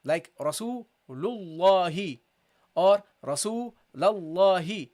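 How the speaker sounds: Rasulullahi slow with a heavy dark lam; heavy Allah. Shown as a demonstration. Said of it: "then you pronounce it heavily".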